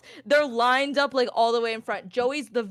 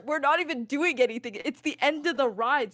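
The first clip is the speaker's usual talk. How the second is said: normal talking voice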